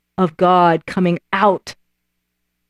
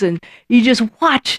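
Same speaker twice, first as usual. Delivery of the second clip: ordinary talk, nothing marked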